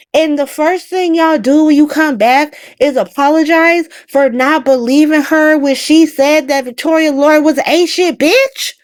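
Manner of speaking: high, indignant, final words slowed; scolding the family characters, high and indignant; exasperated rant. Real, not acted. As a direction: build to an outraged question. Ranting at the family.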